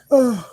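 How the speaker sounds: a loud yawn that ends in a groan; real tired yawn with an ugh. Real, not acted. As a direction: yawn it out, annoyed. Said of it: "sorry for yawning so much".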